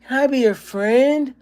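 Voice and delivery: put-on very high, slow, happy child voice; jubilant little kid. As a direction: beam it like a little kid making friends. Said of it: "just so happy and jubilant".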